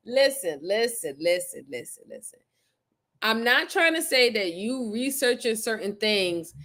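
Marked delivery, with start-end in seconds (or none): 0.1-2.4 fading listen. Real, not acted. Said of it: "calming the chat".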